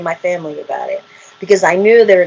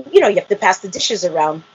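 ordinary talk

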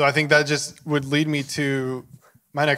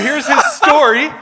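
much higher, louder hype like an ad; mock dating pitch; 11 semitones higher, 8 dB louder than usual. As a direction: sell him to the audience, hammed-up. Joking matchmaker pitch to viewers.

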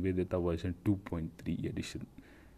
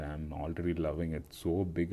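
ordinary talk

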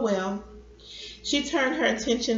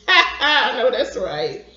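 loud, slow, coming out of a laugh; amused agreement; 3 semitones higher, 8 dB louder, slower than usual. A narrator's reading. Grandson and grandfather sharing a laugh, amused agreement. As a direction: agree heartily through the laugh.

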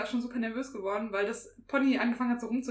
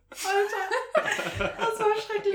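higher, laughing through the words; 9 semitones higher, much slower than usual. Real, not acted. Finishing the exam story for friends, laughing.

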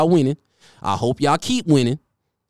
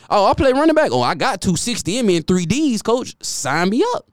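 higher, pushy volunteering; eager player; 8 semitones higher than usual. Retold or imitated. Player begging coach to sign him up, high eager. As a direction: sell yourself to the coach. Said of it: "imagined player continues".

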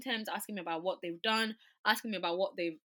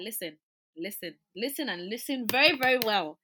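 guns blazing; 3 semitones higher, 8 dB louder than usual. Retold or imitated. Patient confronting the GP, loud and forceful. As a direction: lay down the law to the doctor. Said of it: "I went in, like, guns blazing".

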